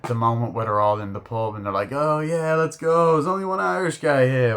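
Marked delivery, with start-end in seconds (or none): 1.9-4.6 high, loud pub banter; mock a rowdy pub shout, high and loud; retold pub cheer.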